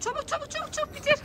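high, rushed urging; urgent hurrying. Real, not acted. Rushing kid to eat. (acele ettiren heyecanlı ses)

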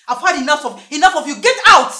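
screaming at her to get out, rising; furious yell building to a scream; furious shout. Acted. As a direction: throw her out at the top of your voice. Wife throws sister out.

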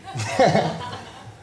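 a wry laugh from the audience; knowing laugh. Real, not acted. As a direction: laugh like you know the story. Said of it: "That was quite, that was quite a knowing laugh".

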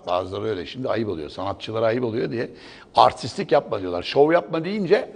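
ordinary delivery

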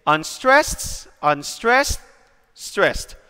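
two rising beats on "unstressed", a falling one on "stressed"; demonstrated stress pattern. Shown as a demonstration. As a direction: lift each "unstressed", then drop on "stressed". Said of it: "So this is".

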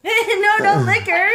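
slow laughing protest. Real, not acted. Baby licking screen, mom laughing.

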